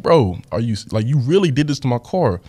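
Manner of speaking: mildly exasperated retold reproach; a touch of indignant anger; mad at brother. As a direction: confront a brother in disbelief. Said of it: "I'm getting so mad".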